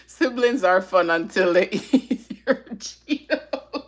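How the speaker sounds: reading aloud while cracking up; slow, giggle-broken read that dissolves into laughter; real laughing. Real, not acted. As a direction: let helpless laughter break up the words. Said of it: "you to make me laugh".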